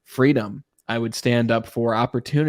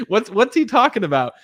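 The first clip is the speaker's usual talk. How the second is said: amused, higher and louder through laughter; laughing; 10 semitones higher, 5 dB louder than usual. Real, not acted. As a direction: laugh while asking in mock puzzlement. Laughing at own imagined puzzled reaction.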